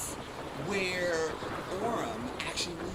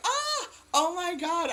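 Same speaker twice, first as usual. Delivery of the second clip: high, louder mock gasp of discovery; acted amazement; 14 semitones higher, 5 dB louder, slower than usual. Player acts out finding the spell tip, mock amazed. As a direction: stretch out a high, astonished reaction. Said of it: "So I'm like".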